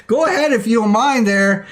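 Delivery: ordinary talk, nothing marked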